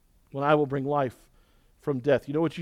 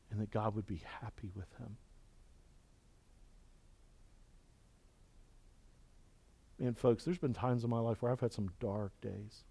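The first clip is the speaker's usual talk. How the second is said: much softer, hushed, tender prayer voice; intimate confession; 3 semitones lower, 9 dB quieter than usual. Acted. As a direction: lean in and confide quietly. Soft confessional prayer section.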